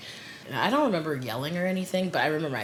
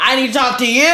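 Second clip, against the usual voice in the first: much louder, higher retold demand; insistent repeat; 8 semitones higher, 12 dB louder, faster than usual. Friend repeats himself after being ignored, loud and insistent. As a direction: act out a friend loudly insisting.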